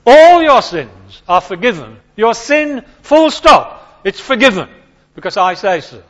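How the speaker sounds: loud, slow, emphatic proclamation; bold decree. Pretend. Preacher mimics a bold claim to forgive, loud and slow. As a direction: pronounce it with full authority.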